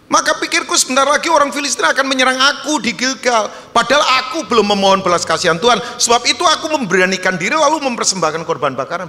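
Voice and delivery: loud, high, rapid Bible reading; forceful scripture reading. Real, not acted. Preacher reads Saul's excuse to the congregation, loud urgent declamation. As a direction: declaim the verse at full voice.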